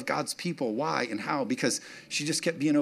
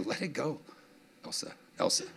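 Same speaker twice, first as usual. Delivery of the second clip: soft Elsa callback; 8 dB quieter than usual. Pretend. Frozen reference, soft aside.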